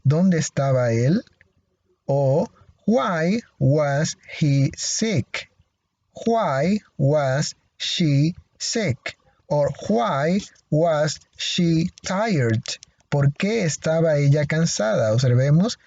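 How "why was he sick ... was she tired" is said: slow model questions. Shown as a demonstration. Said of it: "teacher models questions".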